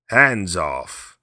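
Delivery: loud, sharp warning; cried out. Acted. Old man warns Kiave off, loud and sharp. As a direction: bark it out to keep him back. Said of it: "cried the old man".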